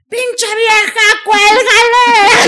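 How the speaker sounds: long wailing screech, cuélgale stretched out; possessed shriek. Retold or imitated. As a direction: shriek like a demon at the wife. Possessed woman screaming at phone call.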